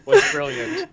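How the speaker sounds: high squeal breaking into laughter; helpless laughing. Real, not acted. Friend praising that bit right there, helpless high laughing. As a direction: squeeze the words out through laughter.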